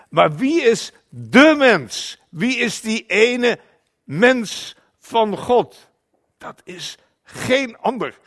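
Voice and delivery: loud, high, slow proclamation; ringing declaration from the preacher; emphatic preaching. Real, not acted. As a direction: preach it out with weight on each key word. Preacher drives home the key question.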